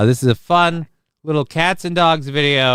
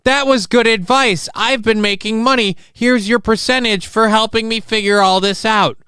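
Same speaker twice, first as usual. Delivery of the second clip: happy client voice; 9 semitones higher than usual. Retold or imitated. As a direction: play the pleased client, upbeat. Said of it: "they come back and they go".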